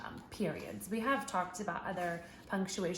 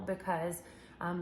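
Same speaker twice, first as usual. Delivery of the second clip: normal talking voice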